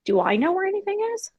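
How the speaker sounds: high, fast panicky question; rushed, alarmed self-doubt; panicked. Retold or imitated. Mom panicking over family money, high and fast.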